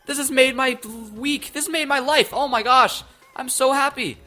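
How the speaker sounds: high, loud, overjoyed yelling; squealing with real delight at the news; real excited shouting. Real, not acted. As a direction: shout for joy, can barely contain it. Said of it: "that's how excited I am".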